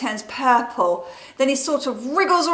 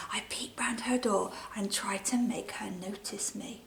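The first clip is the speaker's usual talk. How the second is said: sneaky soft whisper; 2 semitones lower, 12 dB quieter than usual. Acted. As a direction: whisper it like peeping round a door.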